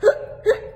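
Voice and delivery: sharp high gasping hiccup intake. Acted. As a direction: gasp in like a hiccup. Hiccup gasp.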